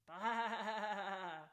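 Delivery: loud bleating sheep baa. A narrator's reading. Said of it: "the strange sounds".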